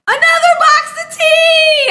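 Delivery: loud, high-pitched squeal of delight; excited squeal. Real, not acted. Streamer finding yet another gift from a friend, excited squeal. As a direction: shriek it with sheer joy.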